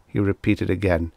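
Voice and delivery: plain reading voice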